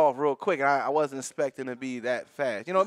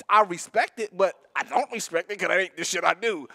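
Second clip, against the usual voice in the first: usual speaking voice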